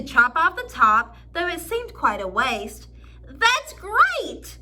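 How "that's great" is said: high, gleeful exclaim; gleeful cry. A narrator's reading. Mister Willoughby cheering the cut tree, gleeful. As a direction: squeal it with glee. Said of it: "cried with a glee".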